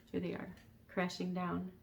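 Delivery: plain reading voice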